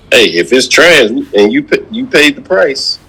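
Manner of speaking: ordinary talk, nothing marked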